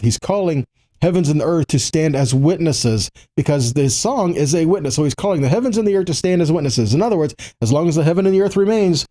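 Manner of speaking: usual speaking voice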